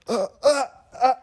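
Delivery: high, choking mock-seizure grunts; jerky, hammed-up gasping noises. Acting out the tape recipient having a seizure, mock choking. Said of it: "has a seizure and dies".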